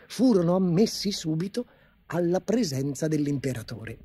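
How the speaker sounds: usual speaking voice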